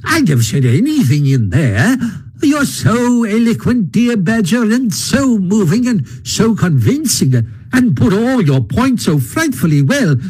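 high, conceited toad voice, flattering; a vain toad buttering up the Badger; boastful Toad. A narrator's reading. Toad's voice in the story.

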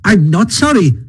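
high-pitched, stubborn and stout; defiant Toad. A narrator's reading. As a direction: unrepentant Toad, swelling into relish. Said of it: "a little sullenly but stoutly".

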